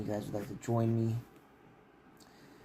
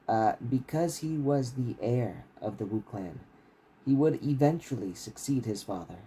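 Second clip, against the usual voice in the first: steady, measured reading of a fantasy novel; story narration; 6 dB louder than usual. A narrator's reading. Author reading his novel to stream listeners, steady narration. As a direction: read the chapter out like an audiobook.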